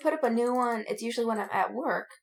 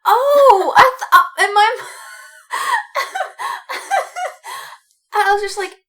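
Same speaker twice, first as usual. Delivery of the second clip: laughing; 8 semitones higher, 10 dB louder than usual. Real, not acted. Laughing at misunderstanding.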